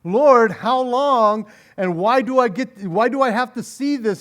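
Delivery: retold crying out. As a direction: imitate an exasperated cry to God. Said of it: "You were crying".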